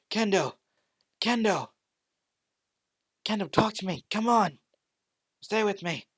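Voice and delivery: loud, high pleading cries; desperate shouting. Acted. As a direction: yell for her to stay alive. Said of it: "as he was just shouting".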